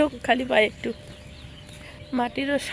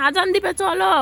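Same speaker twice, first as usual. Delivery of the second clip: calling out; 7 semitones higher than usual. Real, not acted. Calling child back.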